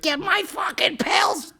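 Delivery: high, slow, yelled demand; mock sitcom screech from offstage; offscreen shout. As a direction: bellow from the next room.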